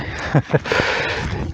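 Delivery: a quiet, breathy laugh at his own clumsiness; soft self-mocking laugh. Real, not acted. Hiker laughing at fumbling his sticks, soft breathy chuckle.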